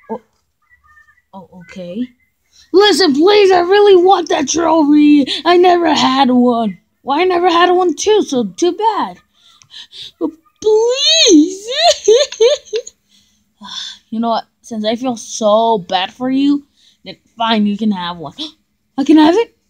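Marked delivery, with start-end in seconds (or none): 2.7-11.7 high begging. Acted. 11.7-12.9 squeaky laugh. Acted. 19.0-19.6 gasped delight. Acted.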